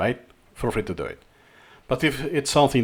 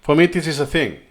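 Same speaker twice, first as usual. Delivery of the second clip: ordinary talk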